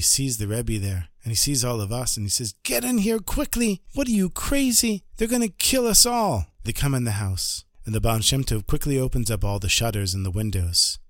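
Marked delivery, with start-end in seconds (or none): 2.6-6.5 much higher, frantic and urgent; frightened householder hissing them inside; panicked. Acted. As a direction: hustle them in, scared out of his wits. Terrified man before a pogrom.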